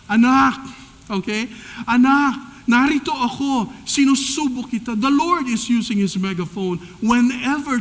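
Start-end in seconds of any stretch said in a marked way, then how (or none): 0.0-0.6 loud fatherly call; loud call to a child; God calling through a megaphone. Retold or imitated. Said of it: "ang Diyos ay gumagamit ng megaphone". 1.9-4.9 loud calling voice; loud divine call; God's megaphone voice. Retold or imitated. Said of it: "ang Diyos ay gumagamit ng megaphone".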